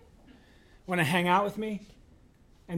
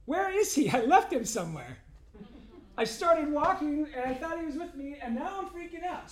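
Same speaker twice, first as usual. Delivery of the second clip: panicked searching; 7 semitones higher than usual. Retold or imitated. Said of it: "feeling bad is where you go".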